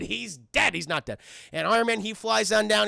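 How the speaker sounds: ordinary delivery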